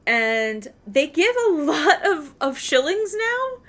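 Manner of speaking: laughing, slow, words rising high. Real, not acted. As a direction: giggle through the words.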